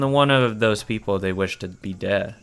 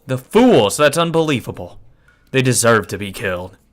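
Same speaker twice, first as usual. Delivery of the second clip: put-on loud furious old men; angry yell; 7 dB louder than usual. Elders at the meeting yelling at the trespassers, furious. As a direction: roar it like an enraged elder. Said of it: "get up and yell".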